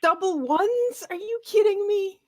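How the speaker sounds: loud, high-pitched disbelief; incredulous outburst. Real, not acted. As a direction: cry out in real disbelief at the roll. Reacts to a terrible dice roll.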